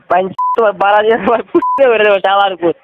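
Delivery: ordinary delivery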